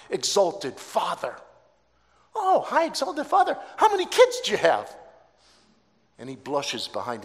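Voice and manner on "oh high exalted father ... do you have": high, loud teasing question; mock-admiring stranger. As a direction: flatter him, then needle him about kids. Stranger mocks Abram's name.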